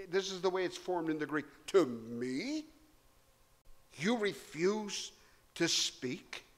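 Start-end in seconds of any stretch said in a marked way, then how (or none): 1.7-6.5 "To me?" stressed in disbelief, slow; incredulous. Shown as a demonstration. Preacher showing Pilate's incredulity at the silent Jesus. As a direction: ask it as if you can't believe it. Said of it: "In the Greek it speaks of Pilate's incredulity".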